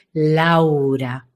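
"Laura" low, long and wide; full, spacious "Laura"; ample and grand. Shown as a demonstration. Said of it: "la amplitud y la grandeza".

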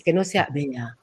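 low, quiet, dulled "Bea"; sunken, lightless delivery of "Bea"; opaque voice. Shown as a demonstration. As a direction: let "Bea" drop soft and dark, without shine. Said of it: "la voz está opaca".

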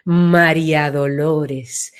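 slow, low and wide; wide, expanded voice. Shown as a demonstration. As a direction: open the sound out, round and broad. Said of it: "se ensancha".

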